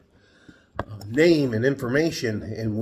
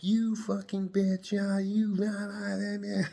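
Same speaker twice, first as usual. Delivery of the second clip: higher, slow mock insult; mocking bickering women; 9 semitones higher, slower than usual. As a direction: imitate the brawling women, sneering. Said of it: "they were like".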